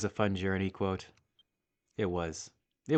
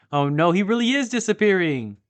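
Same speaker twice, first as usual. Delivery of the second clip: louder, higher cry of dismay; real alarm; 10 semitones higher, 9 dB louder than usual. Real, not acted. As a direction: realise your friend is vanishing. Panic as fairy fades.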